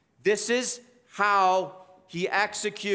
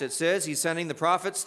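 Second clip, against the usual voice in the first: ordinary talk, nothing marked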